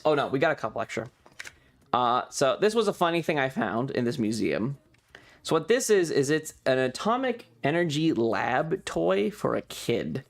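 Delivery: normal talking voice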